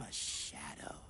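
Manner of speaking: low hushed shadow voice; sneaky whisper. Acted.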